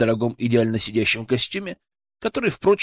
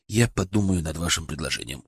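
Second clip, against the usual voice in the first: evasive; 7 semitones lower, 5 dB quieter than usual. A narrator's reading. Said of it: "уклончиво ответил я".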